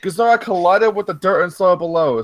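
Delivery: plain reading voice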